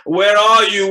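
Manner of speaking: loud searching call; anxious loud call. Acted. Calling out for the servant.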